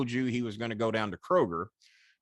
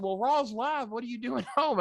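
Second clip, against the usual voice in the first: surprised callers; 12 semitones higher than usual. Retold or imitated. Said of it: "They said".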